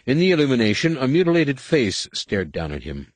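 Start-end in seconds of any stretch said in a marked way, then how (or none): none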